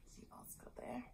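a soft unvoiced question to the kids; hushed storyteller whisper; whispered aside. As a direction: whisper it, drawing them into the picture. Aside to listening children.

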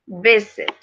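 visit with the weight on VIS; stress on first syllable. Shown as a demonstration. Teacher giving examples of the rule, first-syllable stress on visit. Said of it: "está en la primera parte".

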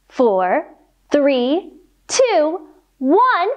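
ordinary delivery

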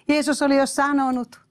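ordinary talk

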